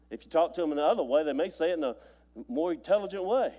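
usual speaking voice

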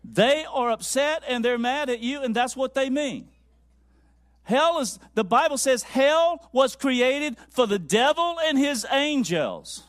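loud, high pulpit proclamation; emphatic sermon delivery; full-force preaching. Acted. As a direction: drive it home to the whole congregation. Preaches to congregation.

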